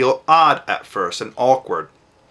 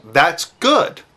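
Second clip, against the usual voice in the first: simple praise; 4 semitones higher than usual. Shown as a demonstration. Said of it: "Just say".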